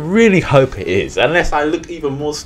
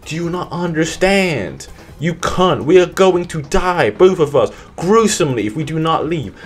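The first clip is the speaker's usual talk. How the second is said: urgent father; 6 semitones higher than usual. Retold or imitated. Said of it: "I was like".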